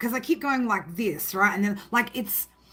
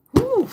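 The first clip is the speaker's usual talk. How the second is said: high whew; 12 semitones higher, 6 dB louder than usual. Real, not acted. Reacting to intense energy.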